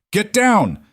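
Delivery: high-pitched narrated shout; urgent shout. A narrator's reading. Holloway warns the nurses during gunfire, urgent high shout. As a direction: call the warning out high and urgent. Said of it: "Holloway was shouting at the nurses".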